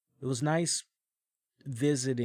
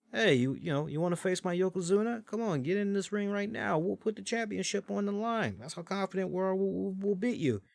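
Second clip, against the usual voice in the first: cocky challenge; 7 semitones higher than usual. Retold or imitated. Manager voicing an in-ring challenge.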